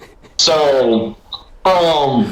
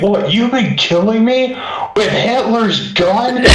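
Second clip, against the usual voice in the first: high, incredulous yelp of alarm; a man realising whose gun killed him; shocked disbelief; 10 semitones higher than usual. Retold or imitated. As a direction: retell him freaking out in disbelief. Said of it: "the next thing that comes out of his mouth is like".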